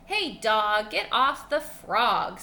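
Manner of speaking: loud, slow frog telling the dog off; bossy frog. Acted. Frog ordering the dog off him, loud and slow. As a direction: shout it out like an annoyed frog.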